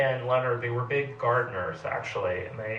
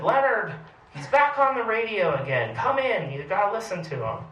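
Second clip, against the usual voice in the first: high, loud call from the window; wife calling Leonard from the window, high and loud; calling out to husband; 10 semitones higher, 4 dB louder than usual. Retold or imitated. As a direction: shout for him to come inside. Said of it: "not in a Texan accent".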